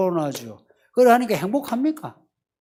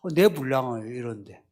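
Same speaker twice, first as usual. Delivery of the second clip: mock self-pity; 9 semitones lower, 6 dB quieter than usual. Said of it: "그게 엄살이 돼요".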